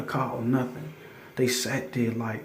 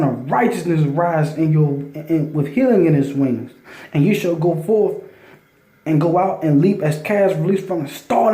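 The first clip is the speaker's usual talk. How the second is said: louder, slowed, preaching cadence; fervent scripture reading; 4 dB louder than usual. Acted. Reading Malachi aloud to his listeners, fervent loud preaching. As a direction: proclaim the verses like a sermon.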